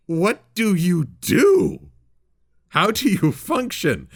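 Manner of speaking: high, slow, baffled rant; mock exasperation. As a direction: stretch it out in hammed-up disbelief. Mock rant at the bayou ponies.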